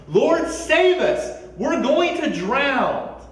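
high, loud, slow cry for rescue; panicked shout. Retold or imitated. Frightened disciples shouting to Jesus in the storm, loud. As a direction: yell it like you're sinking. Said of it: "and they're shouting".

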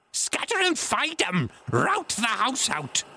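very high, strained pirate yell; Pew's repeated order. A narrator's reading. Blind beggar driving his crew to search, high shout. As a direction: shriek the orders, stretching the last. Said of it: "reiterated Pew, striking with his stick".

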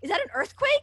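high, loud alarmed question; imitating a startled doctor. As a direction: ask it in mock panic.

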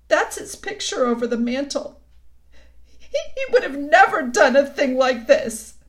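high, loud, wretched voice; tearful confession. A narrator's reading. The faun compares himself to his father, tearful. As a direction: wail it in shame.

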